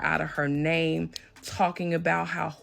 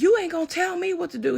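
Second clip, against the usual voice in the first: much higher, louder, faster retold yell; defiant yell; 13 semitones higher, 5 dB louder, faster than usual. Ex defying the security officer, high fast mock yell. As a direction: throw it back at the officer like a tantrum. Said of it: "he's still screaming".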